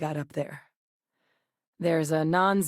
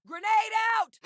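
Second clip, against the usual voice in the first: combat callout; faster than usual. Acted. Throwing grenade in combat.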